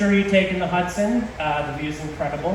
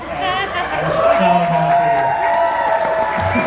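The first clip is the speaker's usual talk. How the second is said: drawn-out, loud, happy cry; overjoyed; 6 dB louder, much slower than usual. Real, not acted. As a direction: let the joy burst out slowly. Emotional moment in her toast.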